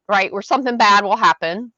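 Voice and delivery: ordinary talk, nothing marked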